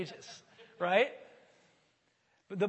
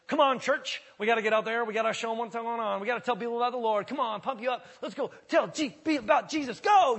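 loud, high hyped-up rally cry; cheerleader pastor; 5 semitones higher, 10 dB louder than usual. Pretend. Pastor mock-rallying his congregation, loud hyped cheerleader shout. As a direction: pump the church up like a cheerleader, building to a shout. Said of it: "I could do the whole cheerleader thing".

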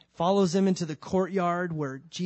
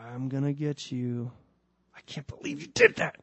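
mock angry; 3 semitones lower than usual. Imagined angry Jesus.

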